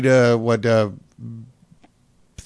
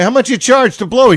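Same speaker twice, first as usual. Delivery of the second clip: mock customer voice; 9 semitones higher, 5 dB louder than usual. Plays customer and attendant voices.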